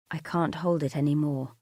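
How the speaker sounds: usual speaking voice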